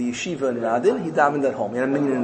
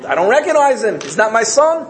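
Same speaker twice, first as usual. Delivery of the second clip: raised, louder acted disowning; indignant denial; 8 semitones higher, 4 dB louder than usual. King looks at the blackened son, raised indignant denial. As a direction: reject him firmly, pitched up.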